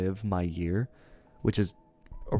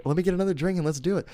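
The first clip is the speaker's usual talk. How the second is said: higher, fast eager dad; eager drunk dad; 9 semitones higher, 3 dB louder, faster than usual. Retold or imitated. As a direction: rush it like a keen tipsy dad. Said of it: "He was like".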